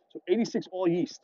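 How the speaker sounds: ordinary talk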